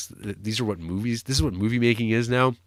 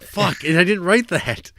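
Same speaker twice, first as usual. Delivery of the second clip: higher and louder, slow mock protest; retold exasperated outburst; 9 semitones higher, 5 dB louder than usual. Writer answering fans at Comic-Con, imitating an exasperated yell. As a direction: blurt it out like a frustrated writer denying the blame. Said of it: "he'd be like".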